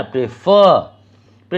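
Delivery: Prefer slowly, pray just touched; slow prefer with weight on ফার; stress on last syllable. Shown as a demonstration. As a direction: brush pray, then hit ফার in prefer. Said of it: "এটা just ছুঁয়ে যাচ্ছি".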